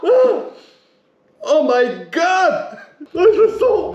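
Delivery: high, loud disgusted exclaiming; shocked cry. Real, not acted. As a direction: cry out as the stench hits. Smell hits him hard.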